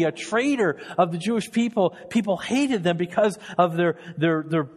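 impassioned sermon build; preaching at full force. Acted. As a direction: preach it with rising fervor.

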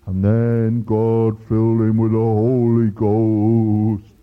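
slow, loud, low monotone intoning; put-on preacher voice. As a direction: intone it like a hammed-up Pentecostal preacher. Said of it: "they put on this voice".

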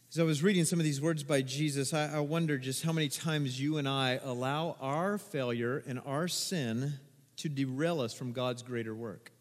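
usual speaking voice

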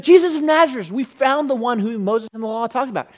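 high, loud burst of news, easing off; excited announcement. Acted. Philip telling Nathanael whom they found, excited. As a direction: blurt out the big discovery.

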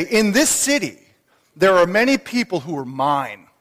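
loud, ringing preacher's voice, slowing on key words; preaching at full force. Acted. The Lord urging Paul, preached at full force. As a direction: thunder the Lord's command to speak out.

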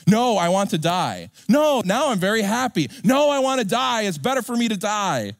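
put-on high, whiny protest like a two-year-old; sulking toddler Jonah. Jonah whining to God like a child at checkout. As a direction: pout it out, stamping your foot.

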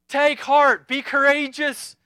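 loud, slow, rousing call; Jesus calling over the storm, loud and slow; ringing command. Acted. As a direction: call out to the frightened disciples with booming conviction. Preacher voicing Jesus' command.

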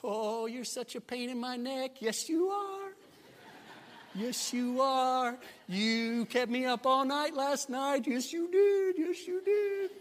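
put-on high, drawn-out cooing voice for a baby; talking to an infant, pitch swooping way up; sing-song baby talk. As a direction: coo sweetly in a very high, stretched sing-song. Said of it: "with that sing-song voice".

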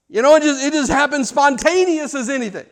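normal talking voice

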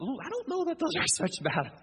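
high, soft, hesitant with a laugh; mock doubt. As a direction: gently query it, half laughing. Mock doubt about Jonah's complaint.

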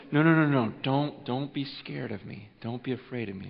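calm a frightened listener softly; hushed, tender angel voice; soft reassurance. Acted. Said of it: "he says".

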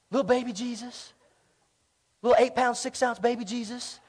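soft, cutesy, mock-adoring; mock cooing. Preacher mocking the cute manger image, soft and cooing. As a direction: coo it like a sweet nativity scene. Repeats phrase, then softly mocking.

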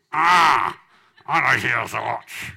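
loud strained giving-up voice; pained grudging cry. Retold or imitated. Man surrendering his watch to the pastor, pained. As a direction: force the words out.

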